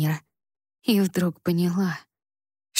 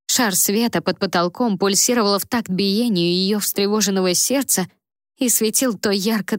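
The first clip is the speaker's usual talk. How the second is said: plain reading voice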